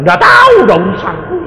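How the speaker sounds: high, loud mock shout; shouted retort. Retelling his dismissive answer to the family, mock shout. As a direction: toss it off with a yell.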